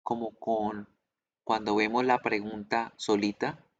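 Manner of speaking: ordinary delivery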